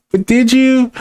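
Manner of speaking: high, pointed but did you; incredulous challenge. Real, not acted. To the chatter, challenging his claim, high incredulous. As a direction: throw the question back in disbelief.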